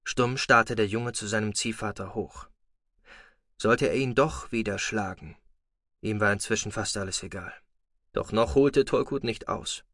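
ordinary delivery

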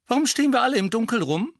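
high, loud and fast woman; impatient young woman calling out; woman's voice. Acted. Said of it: "eine dritte Stimme ... die einer Frau". (Frauenstimme, laut und schnell)

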